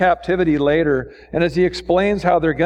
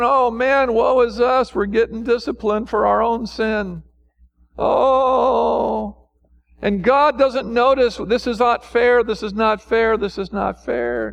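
higher-pitched, complaining imitation; mock whining; 5 semitones higher than usual. Preacher imitating Israel complaining about discipline, mock whining. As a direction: whine it like a put-upon sufferer. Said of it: "See, they're whining".

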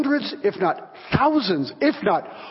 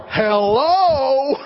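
high, loud incredulous "Hello?"; stunned, can-you-believe-this hello; inner disbelief; 6 semitones higher, 6 dB louder than usual. Retold or imitated. Pastor's inner reaction to the baker's request, loud incredulous hello. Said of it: "I'm going in my heart".

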